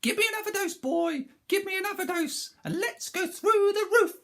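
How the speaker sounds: very high and loud, building to a shriek; greedy screechy crone. Acted. Grandma figure voiced.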